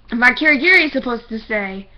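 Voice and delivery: ordinary talk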